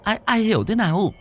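high-pitched, anxious wife voice; fretful wife. Acted. Wife pestering her trembling husband, high and anxious. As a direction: press him with a high, worried nag. Wife urges him to tell.

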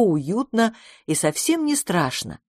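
usual speaking voice

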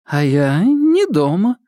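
forced cheerfulness. A narrator's reading. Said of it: "через силу улыбнулась, чтобы прозвучало весело".

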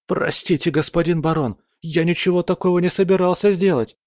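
high, fast frightened babbling; испуганный лепет. Acted. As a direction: plead in terror, words tumbling. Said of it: "залепетал парень". (испуганно лепечет)

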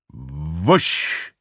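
drawn-out whooshing noise; swelling "wusch" sound effect. Acted. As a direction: imitate a sudden gush. Imitates the gush of water.

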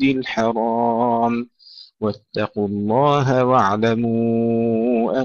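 slow, melodic tajweed chanting with long held vowels; Quran recitation. Acted. Teacher reciting verses for pupils before explaining, measured tajweed recitation.

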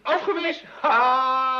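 startled guess. Acted. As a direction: jump to the bad news. Guessing father's request was refused.

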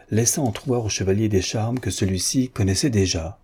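plain reading voice

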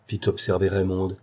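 plain reading voice

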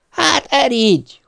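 high, drawn-out angry cry; wife yelling. A narrator's reading. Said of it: "ordította ő".

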